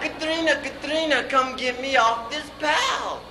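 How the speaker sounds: high-pitched comic holler; yelling for help. Acted. Husband yelling to wife to get him off, high holler. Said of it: "I yelled".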